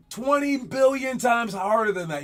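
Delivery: exaggerated warning. Doctors warning a young player about broken ankles, loud and exaggerated. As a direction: oversell it for laughs. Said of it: "They're like".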